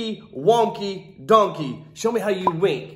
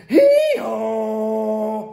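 donkey bray. Acted. Donkey bray in read-aloud.

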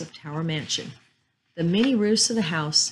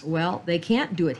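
plain reading voice

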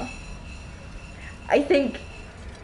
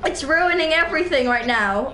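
higher, rattled after a jump scare; scared horror game streamer; 5 semitones higher than usual. Real, not acted. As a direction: shaken gamer, voice jumping up.

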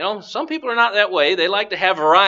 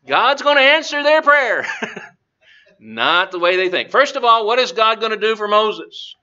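ordinary delivery

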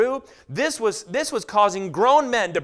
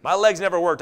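usual speaking voice

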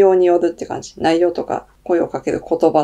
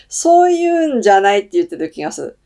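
ordinary delivery

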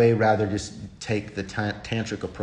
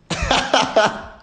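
high laugh; 5 dB louder than usual. Real, not acted. Laughing at own taunt.

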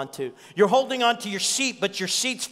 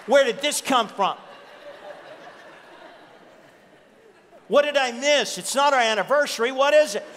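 mock bewildered husband; 6 semitones higher than usual. Mock married-couple confusion.

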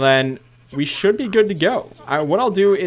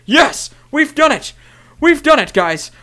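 high, loud victory shout; triumphant cheer; 10 semitones higher, 7 dB louder, slower than usual. Real, not acted. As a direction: celebrate like you won. Portal built, celebrating.

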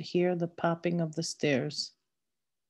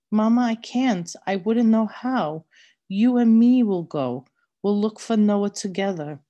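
louder, higher pleading plea through tears; crying like a lost child; 4 semitones higher, 7 dB louder than usual. Acted. Girl pleads with her mad mother, loud and tearful. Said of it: "I was crying like a lost child now".

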